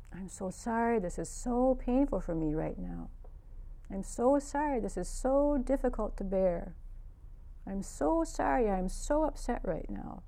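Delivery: soft, slow, gentle self-soothing; tender self-comfort. Retold or imitated. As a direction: comfort yourself softly and slowly. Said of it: "And I said to myself".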